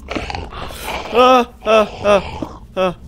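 short high startled yelps; little panicked cries; scared yelps. Real, not acted. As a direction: yelp in fright at a noise. Scared by a sudden noise.

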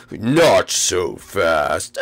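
very low, slow menacing boss; deep villain voice. Acted. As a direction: stop them like a gloating villain. Villain stops the heroes.

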